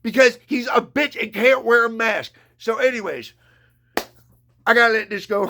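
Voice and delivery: usual speaking voice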